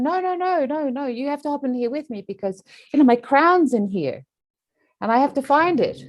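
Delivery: high pleading no no no; drowning person whines she must find her crown; high whiny refusal. Acted. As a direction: beg the healer, high and whiny.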